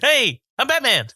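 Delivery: high-pitched comic bragging voice; excited high-pitched mock boast; Aziz Ansari. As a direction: announce it in a high, goofy squeal. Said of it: "You doing Aziz Ansari?".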